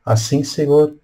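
ordinary talk, nothing marked

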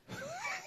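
short high giggle; a quick laugh at the masquerade joke; amused laugh. Real, not acted. Mocking governors in masks, short high giggle.

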